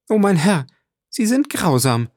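high-pitched cry of dismay; horrified exclamation. A narrator's reading. Julie hears the man may never be found, horrified. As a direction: cry out in alarm, voice lifted high. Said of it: "rief Julie voll Schrecken".

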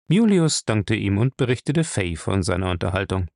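ordinary talk, nothing marked

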